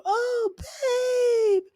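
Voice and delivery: high, crying "oh, babe"; high wailing cry. Retold or imitated. Boyfriend wailing at his girlfriend after the blast, high mock cry. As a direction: wail it in mock despair.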